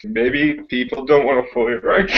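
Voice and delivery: cracking up mid-sentence; real giggles breaking up the line; can't keep straight face. Real, not acted. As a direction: try to say it seriously, then burst out laughing. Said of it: "I can't say it with a straight face".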